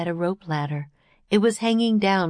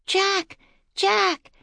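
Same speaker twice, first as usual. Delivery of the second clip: put-on very high girlish call; shouting; 14 semitones higher than usual. A narrator's reading. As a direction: shout his name in a high child's voice. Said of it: "Annie shouted".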